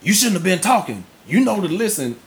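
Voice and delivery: scolding dad. Retold or imitated. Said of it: "Instead of me being like".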